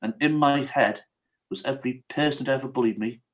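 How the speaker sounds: ordinary talk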